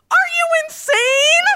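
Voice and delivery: put-on a boyfriend yelling at a stoned girlfriend's absurd question; outraged shout. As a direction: yell it in outrage. He's outraged at her question.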